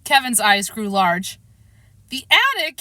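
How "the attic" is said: higher, mildly scared question; a small frightened whimper; boy fears the attic, mild whimper. A narrator's reading. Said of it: "he whimpered".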